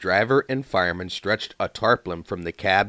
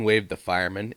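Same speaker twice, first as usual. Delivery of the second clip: usual speaking voice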